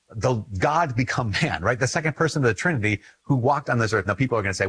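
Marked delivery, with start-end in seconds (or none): none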